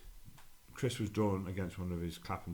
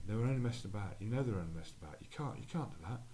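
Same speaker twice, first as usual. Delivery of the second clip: ordinary delivery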